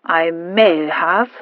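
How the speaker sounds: very slow, wary admission; cautious. Acted. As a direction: hedge carefully, drawing it out. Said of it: "said Sir Rowland cautiously".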